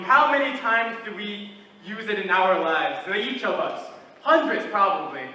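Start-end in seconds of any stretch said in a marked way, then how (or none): none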